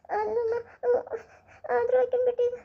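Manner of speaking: tearful, pleading protest; child crying that her sister was left behind. Acted. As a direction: whine it through tears.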